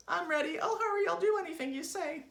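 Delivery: very high, loud, desperate squeal; Mrs. Twit's eager cry. A narrator's reading. Wife promising husband anything, frantic high cry. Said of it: "cried Missus Twit".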